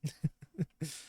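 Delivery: brief high chuckle; short real laugh. Real, not acted. Player amused that the ghost wanted specifics, short high laugh. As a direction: let out a quick high laugh.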